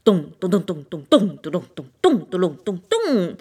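low, loud booming dong dong; the drum showing its sound, low booming beats. A narrator's reading. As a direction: beat it out like a drum.

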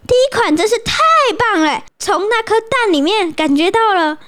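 high, over-the-top praise; gushing friend. Acted. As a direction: gush about the card. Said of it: "他朋友就说". (朋友夸赞卡片，夸张地称赞)

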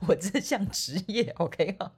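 ordinary talk, nothing marked

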